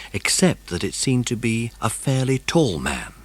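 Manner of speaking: plain reading voice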